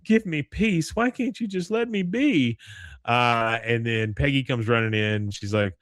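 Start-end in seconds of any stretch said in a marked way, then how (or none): 0.0-2.6 high-pitched imitation of an old lady; pleading old woman. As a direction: beg wearily to be left alone. Voices old woman begging for peace.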